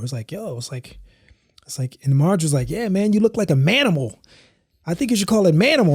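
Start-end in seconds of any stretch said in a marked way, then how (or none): 2.7-5.9 high mocking girl's voice, pitched well up; teasing sister. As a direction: tease him like a kid sister would. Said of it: "her making fun of me".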